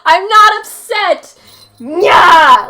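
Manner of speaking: loud, high, drawn-out mock yell; hammed-up furious denial; mock tantrum. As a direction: pretend to lose it completely. Acting out upset character throwing things.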